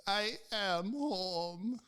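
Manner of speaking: high, very slow insistent wail; imitated freak-out. Confused old patient insists to EMTs, high drawn-out mock wail. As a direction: stretch out each word in mock distress. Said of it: "she's freaking out".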